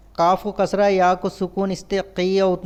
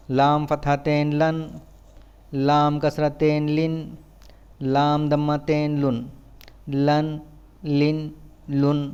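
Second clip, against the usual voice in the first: even, monotone syllable-by-syllable drill; measured classroom letter drill; spelling drill recitation; 3 semitones lower than usual. Shown as a demonstration. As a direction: recite it like a spelling chant, pausing between items.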